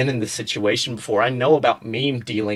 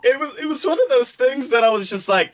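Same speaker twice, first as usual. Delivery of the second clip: laughing; 10 semitones higher than usual. Real, not acted. Laughing explanation.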